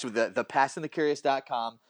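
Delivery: usual speaking voice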